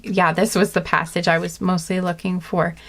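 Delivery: usual speaking voice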